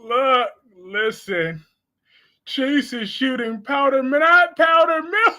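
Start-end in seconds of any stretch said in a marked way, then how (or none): 4.0-5.4 loud, high laughing yell; laughing shout. Real, not acted. Cracking up while joking about Chase's powder milk. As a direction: shriek it through laughter.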